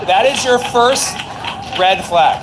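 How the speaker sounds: loud, high, slow emphatic declaration; emphatic callout. Real, not acted. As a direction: call it out loudly, drawing the words out. Emphatic mock verdict on texts.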